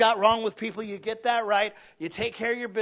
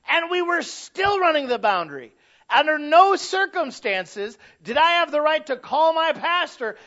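higher, louder preaching, slowing on the punchline; full-force preaching; 7 semitones higher, 10 dB louder, slower than usual. Acted. Pastor telling the church about mornings with kids, high and loud. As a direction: hammer the story home with a laugh in it.

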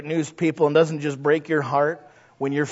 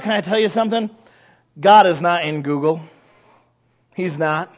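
normal talking voice

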